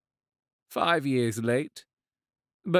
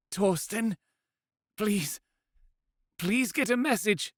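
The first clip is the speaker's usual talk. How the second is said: higher, urgent woman begging; pleading; 10 semitones higher than usual. Acted. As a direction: beg him desperately, please twice. Pleading to vision.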